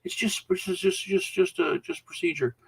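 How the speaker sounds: a cop caught out, fumbling for words; flustered officer. Retold or imitated. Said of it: "they're like".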